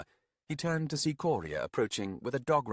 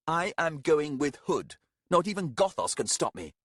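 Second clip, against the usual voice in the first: put-on defiant young man; 7 semitones higher, 4 dB louder than usual. Arathan defiant.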